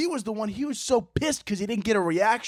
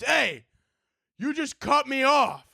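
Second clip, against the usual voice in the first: higher mock accusation; retold angry driver; 5 semitones higher, slower than usual. Driver to the comic through the window, mock angry accusation. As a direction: imitate a driver calling out a grievance. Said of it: "He got so angry".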